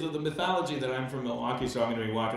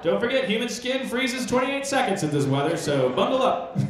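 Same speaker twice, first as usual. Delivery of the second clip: mock TV weatherman, a touch higher and louder; chirpy forecast delivery, bundle up; 9 semitones higher, 5 dB louder than usual. Comic quoting the Milwaukee weatherman, mock forecast. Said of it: "the weathermen tell you as part of their weather report".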